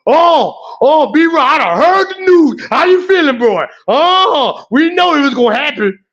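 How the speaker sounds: imitating his supervisor. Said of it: "I can hear him now".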